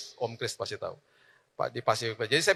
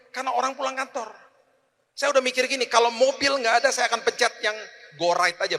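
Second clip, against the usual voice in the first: ordinary delivery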